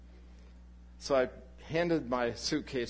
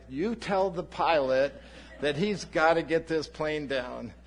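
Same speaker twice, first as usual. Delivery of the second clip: slow, louder, higher insistent order; insistent demand; 4 semitones higher, 5 dB louder than usual. Retold or imitated. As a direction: press him hard to get that plane down. Demanding the plane land.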